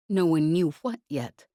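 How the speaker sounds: ordinary delivery, nothing marked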